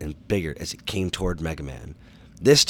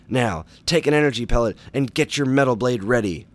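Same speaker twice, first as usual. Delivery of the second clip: higher, louder command over the radio; pressing, raised-voice doctor; urgent instruction; 5 semitones higher, 5 dB louder than usual. A narrator's reading. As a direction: urge the hero back into battle. Doctor urging hero back into battle.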